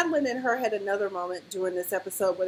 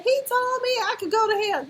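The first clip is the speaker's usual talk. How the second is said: much higher, hammed-up indignant quote; mock outraged Renata; 12 semitones higher than usual. Renata spazzing out at Madeline, a high hammed-up indignant voice. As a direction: squeak it out like a scandalised mum.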